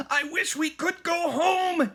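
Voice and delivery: high, slow shouted wish; a boy's pleading shout. A narrator's reading. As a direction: call it out, stretched and high. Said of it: "he shouted".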